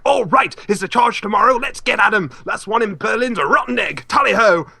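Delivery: hearty, gung-ho rallying cry; rally the troops with jolly over-the-top zeal; hammed-up boisterous charge; overly boisterous officer. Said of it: "this overly boisterous one".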